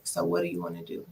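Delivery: retold boyfriend, voiced low; boyfriend's voice. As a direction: drop the voice low to play him. Boyfriend voiced in her story.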